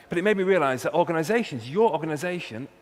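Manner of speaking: normal talking voice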